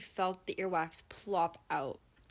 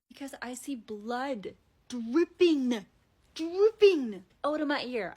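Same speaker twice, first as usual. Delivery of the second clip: alarmed; 3 semitones higher, 7 dB louder, slower than usual. Retold or imitated. Seeing blood from her ear.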